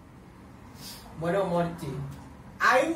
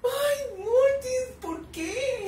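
whiny fake cry; 12 semitones higher, much slower than usual. Real, not acted. Fan whines at show.

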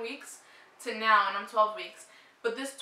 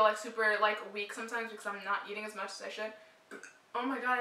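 ordinary delivery

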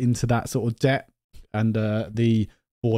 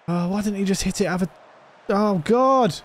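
high, anguished match groan; exasperated lament; 8 semitones higher than usual. Real, not acted. Player watching his team waste a last chance, exasperated. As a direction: wail at the wasted chance, pitch up.

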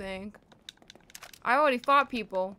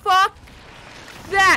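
loud emphatic shout; 8 semitones higher, 13 dB louder than usual. Real, not acted. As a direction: yell it out. Final shout.

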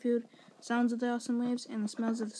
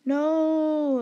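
long, high, loud cry of refusal; exclaimed cry; 5 semitones higher, 8 dB louder than usual. Acted. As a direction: cry it out in protest, drawn out. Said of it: "exclaimed Robert".

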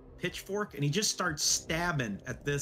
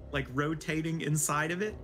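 ordinary talk, nothing marked

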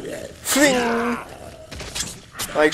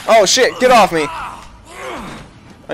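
panicked shout; 7 semitones higher, much slower than usual. Real, not acted. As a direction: shout it while swarmed. Attacked by zombies.

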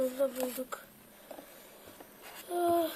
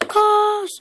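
shouted urging; 7 semitones higher, 6 dB louder than usual. Pretend. Urging a partner to run.

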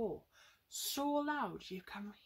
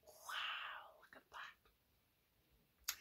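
awed whisper; much slower than usual. Acted.

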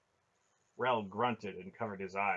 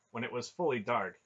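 ordinary delivery

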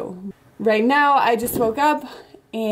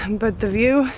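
ordinary talk

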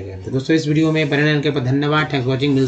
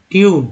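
Tune said higher and louder; emphatic model word; 5 semitones higher, 6 dB louder than usual. Shown as a demonstration. Teacher stressing the model word tune. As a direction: hit tune up high and loud. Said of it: "T U N E को क्या बोलेंगे".

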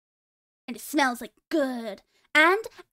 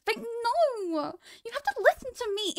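higher, bratty, laughing tantrum; mock hissy fit; 7 semitones higher than usual. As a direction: pout and stamp your foot, half laughing. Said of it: "having a proper hissy fit".

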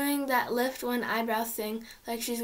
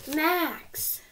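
a higher, shifted bark of the name; a hint of the furious teacher; angry teacher calling; 4 semitones higher than usual. Teacher bangs on the door, lightly voiced angry call.

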